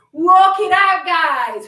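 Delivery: loud call to the class. Real, not acted. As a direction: call it out over the music. Calling to class after countdown.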